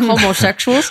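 exaggerated hillbilly twang on Homosexuals; hillbilly accent. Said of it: "Be a hillbilly".

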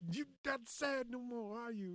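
high, put-on taunting voice; mock menacing Ike. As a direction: sneer it like a smug abuser.

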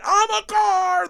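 slow, high "I'm a car" stretched out; mock proud boast. Retelling his Russian blunder, slow high mock boast. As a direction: drag out the silly claim proudly. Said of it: "They're like".

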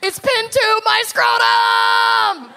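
high, slow screamed parody of the spell; high stretched-out yell of a meme spell; mock scream. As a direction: shriek it like a wizard casting a spell. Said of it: "Where he's screaming".

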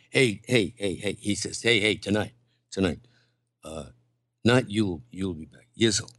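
low, quiet mock coaching tone; a hint of Tony coaching, murmured low; imitating Tony. Tony coaching him in the makeup chair, lightly imitated. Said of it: "and he says".

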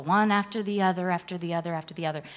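fading away. Real, not acted. Listing on, trailing away.

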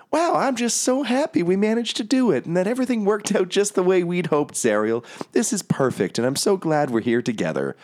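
tiny golden elephant beaming at her angel friend; Lulu's happy voice. Acted. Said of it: "And Lulu says".

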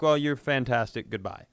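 ordinary talk

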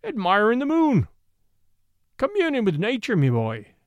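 high, loud mocking Irish brogue; an Irish officer mocking the detective, loud Irish brogue; pure Irish. A narrator's reading. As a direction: fling it out in a broad Irish brogue. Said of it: "he cried in pure Irish".

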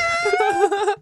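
squealing laugh; a quick high-pitched laugh; high giggle. Real, not acted. Laughs before acted reply.

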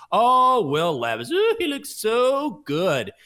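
high, loud swooning over a player; mock gushing. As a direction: gush with exaggerated awe, stretching the words. Mocking gushing hype over Levis.

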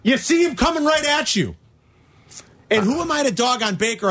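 high-pitched, indignant scolding; upset. Real, not acted. Bettor scolding Baker over a sack, upset. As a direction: scold the player, pitched high with pauses between jabs. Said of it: "You sound upset".

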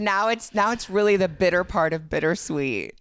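high wail of disappointment; sad. Real, not acted. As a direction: lament that it's nearly over. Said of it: "No, I'm sad".

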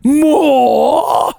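high, drawn-out mock British wail; Oliver Twist. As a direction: plead like a hungry English orphan. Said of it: "you'd need Oliver Twist".

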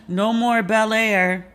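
slow, drawn-out mournful whine; mock sad lament. Host mourning the show's end, slow whine. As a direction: drag it out like a lost love. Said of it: "re-sad 'cause I'm like".

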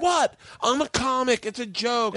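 high, slow mock-innocent protest; imitating an indignant comic; defensive protest. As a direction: plead your case way up high. Comic defends his joke, retold.

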